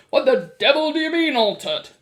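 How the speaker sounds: loud, blustering demand; shouted. A narrator's reading. Mr. Jenkins demands an explanation, shouting. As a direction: demand an answer at full volume. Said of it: "shouted Mister Jenkins".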